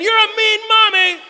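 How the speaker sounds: put-on high, drawn-out toddler screaming; full-force imitation of a raging little girl; child's yell. Daughter yelling at her mother through tantrum, screaming. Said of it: "She's yelling at Megan".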